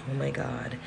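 ordinary talk, nothing marked

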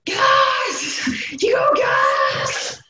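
a very high, drawn-out cry of gas; high shouted jingle. Retold or imitated. Imitating the gas van's call to the listener, a high slow shout. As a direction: shriek it like a van loudspeaker. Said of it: "it just goes".

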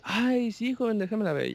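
high, imitating an older lady. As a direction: play the eager older lady asking to see the photo. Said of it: "luego me dice". (imitando a una señora, voz aguda)

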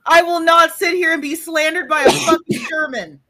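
high, loud, hammed-up indignant rant; theatrical fury, voice cracking at the end; mock outrage. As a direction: play the wounded Texan to the hilt. Outraged Texan defending herself.

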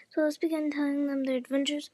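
ordinary delivery, nothing marked

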